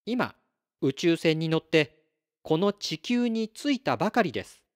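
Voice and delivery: やや高めの声の、軽く演じた星の子; 星から来た子として丁寧に名乗る; 星の子の自己紹介. Acted. 星の子キラスの台詞を演じる. (星の子キラスを軽く演じる声)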